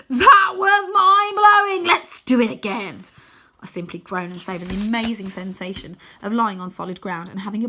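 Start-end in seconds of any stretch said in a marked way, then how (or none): 0.1-3.1 high, loud and slow thrill; excited cry. Acted. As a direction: whoop with glee. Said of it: "leaps to his feet and cries".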